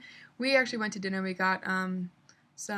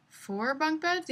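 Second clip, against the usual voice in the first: unsure question; 8 semitones higher, much slower than usual. Real, not acted. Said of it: "Yeah, four bunk beds".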